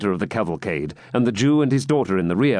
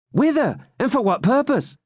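much higher doubtful jester; questioning jester; 14 semitones higher than usual. A narrator's reading. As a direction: ask warily where to. Jester voiced in story.

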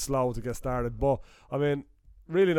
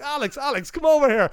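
high, loud mock call across the room; calling over; 12 semitones higher, 6 dB louder than usual. Kane Hodder calling the Child's Play actor over, high loud call. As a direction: shout him over, urgent. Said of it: "He's like".

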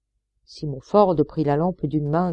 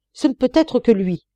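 higher, louder, convinced; certain cry; 7 semitones higher, 5 dB louder than usual. A narrator's reading. Son to the engineer, insisting loudly on the culprit. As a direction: drive it home with certainty. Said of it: "s'écria Harry".

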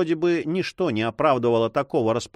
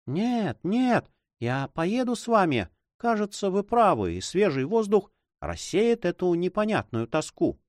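put-on higher girl's voice; young girl; 6 semitones higher than usual. A narrator's reading. Girl agreeing to ride with her guardian, higher voice. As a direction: agree quickly, voice pitched up. Juana changes her mind.

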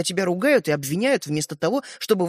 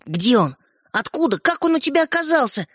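quick, excited questions; eager questions; 9 semitones higher than usual. A narrator's reading. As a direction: pepper him with questions. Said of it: "забросал брата вопросами". (взволнованные расспросы)

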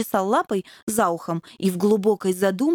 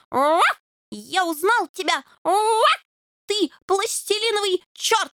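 higher, rising puppy threat; menacing growl; 9 semitones higher than usual. A narrator's reading. Fox terrier growls menacingly at the plasticine devil. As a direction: snarl the accusation as a brave pup. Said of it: "грозно зарычал".

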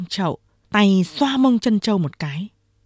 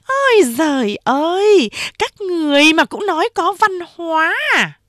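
high, loud scoffing exclamation; laughing mockery; 10 semitones higher, 4 dB louder than usual. A narrator's reading. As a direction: jeer at them, fresh from a laughing fit. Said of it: "cười rũ ra".

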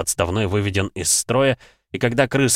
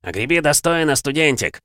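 taunting; 6 semitones higher than usual. Acted. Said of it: "поддержал свина какой-то мужчина".

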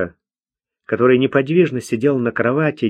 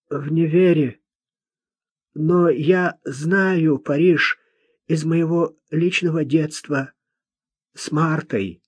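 high-pitched, laboured recital of points; higher-voiced young man explaining himself; odd, earnest young man; 5 semitones higher than usual. A narrator's reading. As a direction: voice a simple lad trying to speak properly.

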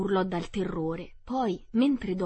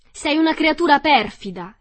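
high and loud, hurling it at her; furious shout; 7 semitones higher, 11 dB louder than usual. Acted. Dorothy screaming at the wicked witch, furious. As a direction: scream the insult. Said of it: "le urlò Dorothy".

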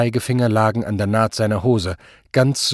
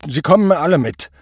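higher, louder, decisive order; a flustered officer takes charge; overwhelmed policeman; 6 semitones higher, 5 dB louder than usual. A narrator's reading. Overwhelmed policeman to the suspects, firm raised voice. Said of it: "beschloss der sichtlich überforderte Polizist".

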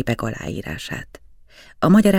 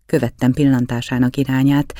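plain reading voice

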